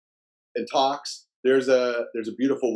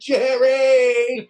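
loud, high-pitched shout of a name; retold excited call; 11 semitones higher, 6 dB louder than usual. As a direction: hail a celebrity, pitched way up. Calling out to Springer in story.

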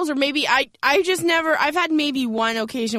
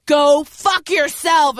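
slow hostile; 6 dB louder, much slower than usual. Retold or imitated. Boyfriend hostile, retold.

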